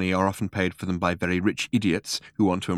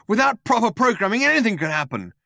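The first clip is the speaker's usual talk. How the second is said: high, urgent warning; pitched-up agitated protest; alarmed objection; 12 semitones higher, 3 dB louder than usual. A narrator's reading. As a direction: warn them frantically. Alarmed objection before impact.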